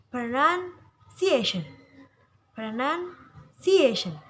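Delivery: "Pronunciation" with the A syllable stretched and stressed; slow "Pronunciation", leaning on CIA. Shown as a demonstration. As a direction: draw out and press the CIA in "Pronunciation". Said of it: "Jadi yang ditekan seperti ini".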